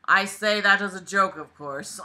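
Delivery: ordinary talk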